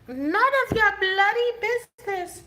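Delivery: high mock British accent; playful British accent imitation; imitating British accent. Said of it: "I love his accent".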